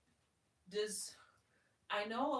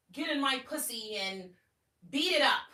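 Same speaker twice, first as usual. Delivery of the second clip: loud, high, pushy demand; demanding command; 8 semitones higher, 12 dB louder than usual. Shown as a demonstration. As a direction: bark it like an order. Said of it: "profoundly different than".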